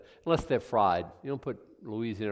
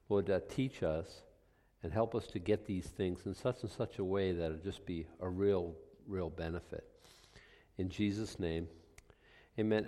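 prayer; 3 semitones lower, 3 dB quieter than usual. Acted. Pastor praying before the study, softly and reverently. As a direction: speak quietly to God, unhurried.

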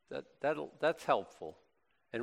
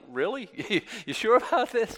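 nervous Moses; 8 semitones higher, 3 dB louder than usual. Retold or imitated. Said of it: "And he's like".

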